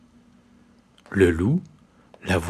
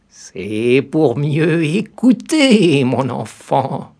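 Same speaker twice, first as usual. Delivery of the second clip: high, very slow sly granny voice; the disguised wolf reassuring the girl; 5 semitones higher, 7 dB louder, much slower than usual. A narrator's reading. Wolf answers as grandmother.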